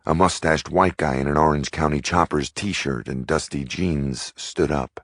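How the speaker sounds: usual speaking voice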